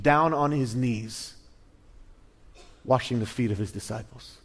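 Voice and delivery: usual speaking voice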